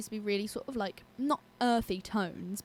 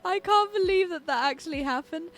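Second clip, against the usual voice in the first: laughing disbelief; 9 semitones higher, 6 dB louder than usual. Real, not acted. Laughing at mishap.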